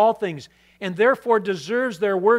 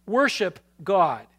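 Worship God said slowly, each word weighed; slow repeat; slower than usual. Real, not acted. Pastor repeating to the congregation, slow and deliberate.

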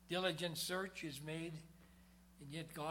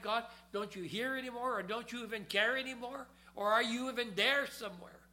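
despairing plea; 6 semitones higher, 4 dB louder than usual. Retold or imitated. Voicing believers' despairing questions to God.

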